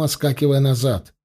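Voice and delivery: plain reading voice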